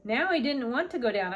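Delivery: ordinary delivery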